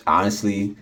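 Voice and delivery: ordinary talk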